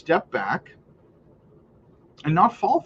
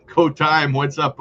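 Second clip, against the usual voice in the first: usual speaking voice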